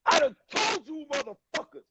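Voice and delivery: high, furious outburst imitating a star losing it; spazzing out. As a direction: blow up at the crew who keep calling you. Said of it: "And spazzed on him".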